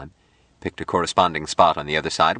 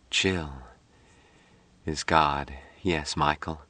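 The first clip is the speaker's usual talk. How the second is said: heavy drugged murmur; 7 dB quieter, slower than usual. A narrator's reading. Patricia's drugged whisper carries on.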